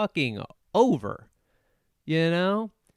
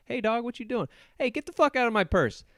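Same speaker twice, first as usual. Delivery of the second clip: higher, quick, mock outrage; mock indignant; 8 semitones higher, faster than usual. To a stranger reaching into her purse, mock outrage. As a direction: snap at a hand going through your purse. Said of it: "You'd be like".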